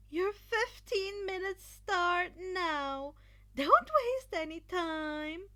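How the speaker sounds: slow, loud, high countdown call; exclaiming Midnight. A narrator's reading. Midnight starting the clock for the students, loud and high. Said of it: "Midnight exclaimed".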